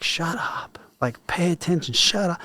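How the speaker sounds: high sharp mock yell of shut up; exhausted yelling at a classmate; retold yell. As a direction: snap at him to shut up and pay attention. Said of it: "I yelled at that person".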